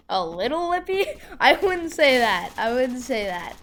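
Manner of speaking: loud, high-pitched disbelief with a laugh; incredulous. Real, not acted. Kid disputing mom's mild wording, loud and incredulous. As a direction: scoff loudly at the understatement, pitch jumping up. Scoffs at mom calling it little.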